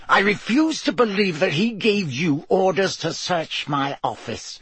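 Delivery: through clenched teeth. A narrator's reading. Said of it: "said Snape through clenched teeth".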